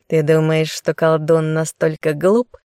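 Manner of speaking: ordinary delivery, nothing marked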